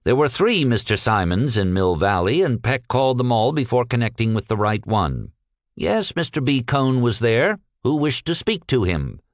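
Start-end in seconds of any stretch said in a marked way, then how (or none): none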